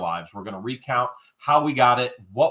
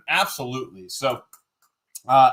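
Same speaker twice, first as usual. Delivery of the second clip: ordinary talk, nothing marked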